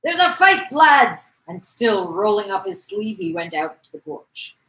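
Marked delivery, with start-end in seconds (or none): 0.0-1.3 high, loud alarm to the mates; rally the lads with a shout; calling out. Acted. Lad alerts mates to fight.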